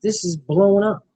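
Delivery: ordinary talk, nothing marked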